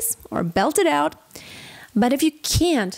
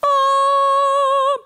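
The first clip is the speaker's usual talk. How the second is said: much higher, louder "ah" placed far back; wrong, pushed-back ah; 12 semitones higher, 4 dB louder than usual. Shown as a demonstration. Coach shows the wrong, back-placed vowel. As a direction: sing a swallowed back "ah". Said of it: "Don't go".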